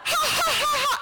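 high, loud cackling ha ha ha; dad laughing at his own joke; madman laugh. Retold or imitated. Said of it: "He's laughing like a madman".

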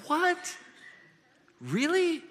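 high, incredulous double take; can't believe what he just heard; stunned disbelief. Retold or imitated. Joseph reacting to the angel with stunned disbelief.

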